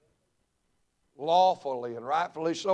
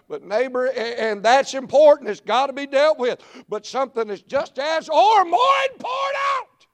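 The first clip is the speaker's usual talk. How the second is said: higher and louder, building to a hoarse cry; full preaching shout; 12 semitones higher, 12 dB louder than usual. Acted. Preacher to congregation, building to a full shout. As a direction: climb into a full revival holler.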